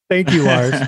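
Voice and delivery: mock thanks with the name punched; a dry, ironic thank-you; sarcastic thanks. Real, not acted. Thanks after being overcharged.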